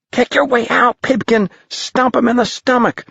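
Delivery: put-on high kid calling advice; high young boy urging. A narrator's reading. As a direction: shout encouragement to a swallowed friend. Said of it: "someone suggested".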